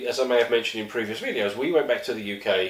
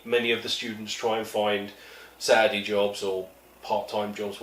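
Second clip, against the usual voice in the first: ordinary delivery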